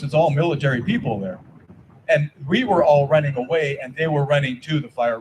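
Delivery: normal talking voice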